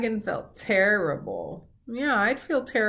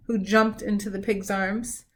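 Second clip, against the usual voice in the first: plain reading voice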